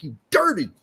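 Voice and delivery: furious high-pitched yell at a pan; angry yelling. Retold or imitated. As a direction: scream abuse at the dirty pan. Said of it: "I was getting fucking pissed off".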